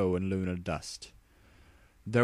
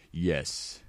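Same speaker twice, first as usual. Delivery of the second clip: ordinary delivery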